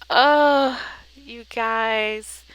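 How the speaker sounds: high, loud delighted oh; thrilled oh. Real, not acted. Vlogger showing viewers the finished spread, thrilled. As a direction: gasp with delight at the result.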